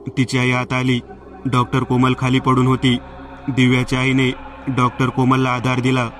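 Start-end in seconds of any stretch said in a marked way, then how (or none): none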